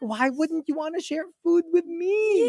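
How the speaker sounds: high, whiny mock-tearful plea; injured. Boyfriend retells food being withheld, injured whine. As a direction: plead it like a wounded child, rising. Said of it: "I felt so injured".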